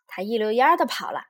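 plain reading voice

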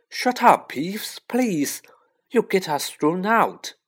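high, urgent pleading; anxious high-voiced plea. A narrator's reading. Hushing Peeves while fleeing.

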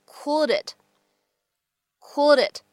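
"cooled it" run together as "cool-dit"; linked words. Shown as a demonstration. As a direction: carry the D of "cooled" onto "it". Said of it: "又可以做一个连".

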